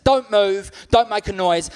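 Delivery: hammed-up mugger barking demands; mock mugger threat.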